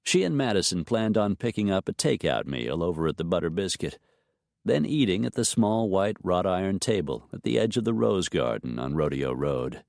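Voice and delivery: usual speaking voice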